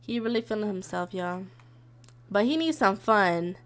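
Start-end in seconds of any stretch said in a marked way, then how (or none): none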